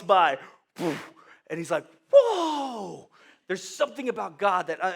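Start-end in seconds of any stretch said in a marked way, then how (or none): 2.1-3.1 high, loud, drawn-out amazement; awestruck. Acted. Moses as God walks by, loud awestruck cry. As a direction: let out a long stunned cry.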